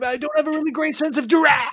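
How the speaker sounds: very high, strained little voice; comic high-pitched dying plea; dying captive's protest. Acted. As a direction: squeak out a last excuse. Stabbed captive protests as he dies.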